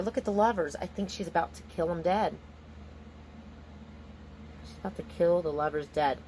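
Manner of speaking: normal talking voice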